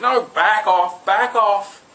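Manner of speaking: enraged. A narrator's reading. Witch snapping at the mirror, enraged and loud. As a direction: shove him away in a rage. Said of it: "She'd get all enraged".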